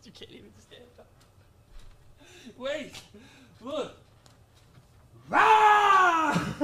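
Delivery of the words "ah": T-Rex roar. Pretend. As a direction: roar like a dinosaur. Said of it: "I sound like a fucking T-Rex".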